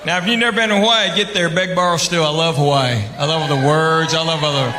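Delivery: ordinary talk, nothing marked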